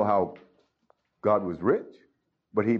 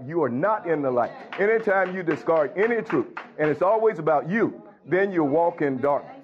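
higher, driving sermon voice, pushing each point home; full preaching; 5 semitones higher than usual. Acted. Pastor rebuking his congregation, preaching at full force. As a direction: preach it hard at a congregation that won't listen.

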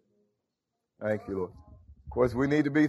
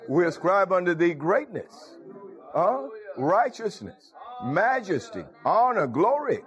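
preaching cadence; 6 semitones higher, slower than usual. Acted. Preacher lifting the congregation in praise, building. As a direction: lift the praise line by line like the pulpit. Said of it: "Now, see, I'm talking like this".

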